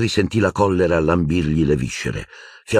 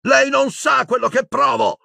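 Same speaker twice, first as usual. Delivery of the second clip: much higher, furious yell; screaming. A narrator's reading. Boy screaming at the calm headmaster. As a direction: hurl it across the room. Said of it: "urlò Harry".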